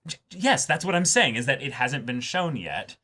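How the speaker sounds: ordinary talk, nothing marked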